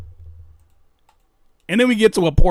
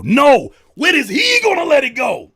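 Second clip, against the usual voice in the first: higher, louder retort; yelling back at doubting viewers; shout; 10 semitones higher, 8 dB louder than usual. Real, not acted. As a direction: fire it back at full volume.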